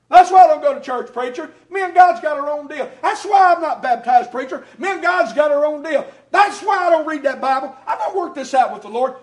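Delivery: loud defiant shout at the preacher; hammed-up shouting objector; mock defiant objector. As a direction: bellow it like a stubborn man who won't come to church. Imitating a stubborn churchgoer.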